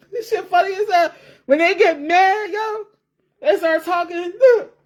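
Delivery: high, laughing through the words; can barely get it out for laughing; laughing hard. Real, not acted. Telling viewers about mad Jamaican women, cracking up.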